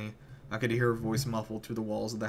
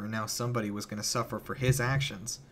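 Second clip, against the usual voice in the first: usual speaking voice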